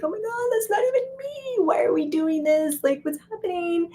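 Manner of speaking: high, breathless mock panic; a high, alarmed inner voice; panicked inner voice. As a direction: squeak it out like you've caught yourself lying. Panicked inner voice mid-lie.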